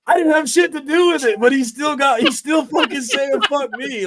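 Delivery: high, loud incredulous outburst; real high-pitched indignation; exasperated protest. Real, not acted. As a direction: protest the blame in a high shout. Exasperated at being blamed.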